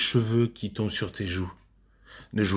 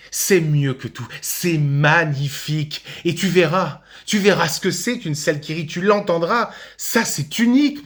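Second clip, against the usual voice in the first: rising, ever higher-pitched enthusiasm; building rapture; 5 semitones higher than usual. Acted. Father promising his son the joy of a laughing audience, rapturous. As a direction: swept away, climb in pitch toward a peak. Father rhapsodises about the stage.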